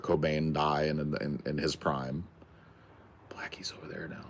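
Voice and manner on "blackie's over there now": hushed whisper. Real, not acted. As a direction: whisper so the cat can't hear. Pointing out the cat quietly.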